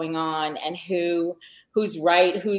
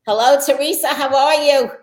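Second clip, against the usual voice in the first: high, loud Long Island sister impression; brash Long Island call, pitched way up; Long Island twang; 7 semitones higher, 8 dB louder than usual. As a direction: imitate a Fran Drescher-style Long Island greeting. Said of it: "She sounds like Fran Drescher".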